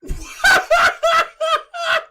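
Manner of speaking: loud, high burst of laughing; real uncontrollable high laugh; explosive laughter. Real, not acted. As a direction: crack up in a shrieking cackle. Bursts out at the clip.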